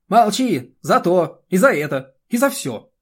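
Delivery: loud, high, punchy outburst; angry scolding. A narrator's reading. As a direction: shout down his protest point by point. Furious scolding in acted quarrel.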